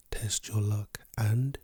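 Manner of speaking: normal talking voice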